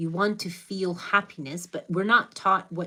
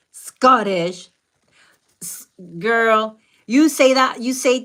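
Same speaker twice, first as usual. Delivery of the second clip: playful disbelief; 2 semitones higher, 9 dB louder than usual. Real, not acted.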